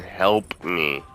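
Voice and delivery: ordinary talk, nothing marked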